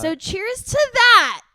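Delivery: loud, slow, high cheer; big toast. Acted. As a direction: raise a toast grandly.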